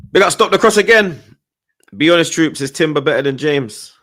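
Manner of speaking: loud, high, excited fan shout; hyped shout. Real, not acted. Taunting rival fans, a loud excited shout. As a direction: yell it at your mates with full pride. Fan hyping his team again.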